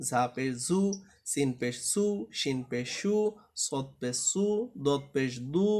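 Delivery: ordinary talk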